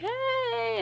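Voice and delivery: high, drawn-out singsong greeting; calling out. Retold or imitated. Retold friends popping out, calling.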